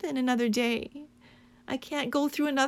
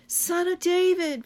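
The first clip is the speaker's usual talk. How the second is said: raised, higher cry to a passing Jesus; 6 semitones higher, 5 dB louder than usual. Retold or imitated. Blind beggar crying to Jesus, raised and pleading. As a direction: call out to Jesus over the crowd. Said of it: "crying out when he hears".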